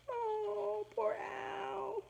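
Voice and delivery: high, soft sympathetic coo; pitying. Acted. Teacher cooing at the class over lonely Al, soft pity.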